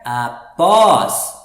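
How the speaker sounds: "após" with a light A and a loud, long PÓS; stress on last syllable. Shown as a demonstration. Teacher showing where the accent falls, stressed last syllable. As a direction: punch the "pós" of "após", drawn out. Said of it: "o A a gente lê um pouco mais baixo".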